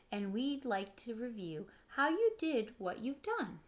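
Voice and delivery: plain reading voice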